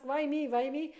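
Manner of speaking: mock self-pity. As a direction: play the self-pitying asker, lightly mocked. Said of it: "we'll ask".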